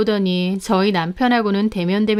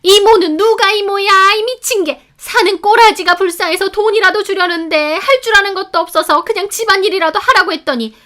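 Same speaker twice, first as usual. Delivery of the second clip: much higher, louder fast scolding; mother-in-law raging at the maid; furious tirade; 11 semitones higher, 7 dB louder than usual. A narrator's reading. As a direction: tear into the maid. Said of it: "노려보시더라고요".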